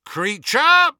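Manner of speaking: high, loud summoning shout. A narrator's reading. Harry summoning the house elf, calling loudly. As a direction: call out sharply to summon a servant. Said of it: "he raised his voice and called".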